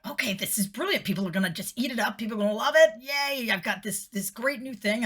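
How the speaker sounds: high, eager rush; excited. Retold or imitated. Thinking the new ghost tour is brilliant, excited and high.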